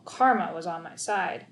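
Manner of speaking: ordinary delivery, nothing marked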